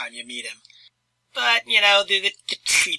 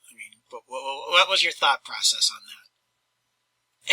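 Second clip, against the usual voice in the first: whispering; 2 semitones lower than usual. Real, not acted. Whispered aside questioning viewer.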